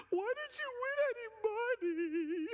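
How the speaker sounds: hammed-up, high-pitched childish wail; imitating a crying child. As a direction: wail it like a heartbroken little kid, played for laughs. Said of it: "I cried like a s".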